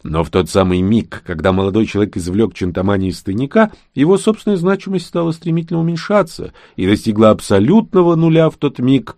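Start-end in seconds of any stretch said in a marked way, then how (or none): none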